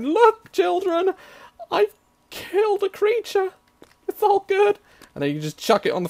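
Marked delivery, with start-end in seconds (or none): 0.0-4.8 high, loud hammed-up parent voice; mock parent. Hammed-up mum announcing the kill to her children, high and loud. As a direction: boast to the children like a proud mum. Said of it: "wander outside going".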